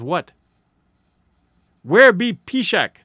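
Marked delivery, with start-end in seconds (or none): 1.8-3.0 sharp, slow and louder, higher demand; an old squaw snapping her question, louder and higher; sharp query. Acted. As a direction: bark it at him like an impatient old woman. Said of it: "queried sharply".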